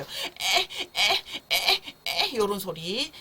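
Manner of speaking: short, separate whiny "eh" bursts; gassy baby fussing. Shown as a demonstration. Teacher imitates a gassy baby, short fretful grunts. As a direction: imitate a baby grizzling with gas. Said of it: "찡얼거리고 보채는 것처럼 들려요".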